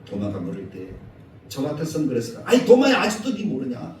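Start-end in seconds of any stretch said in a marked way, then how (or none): 2.4-4.0 sharp scolding call to Thomas; preacher imagines scolding Thomas, loud and sharp. Pretend. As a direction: scold him like an impatient teacher. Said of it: "이렇게 야단쳤을 것 같아요".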